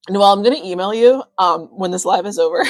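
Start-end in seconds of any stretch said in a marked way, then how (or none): none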